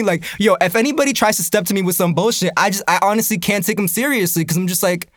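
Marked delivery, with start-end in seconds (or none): none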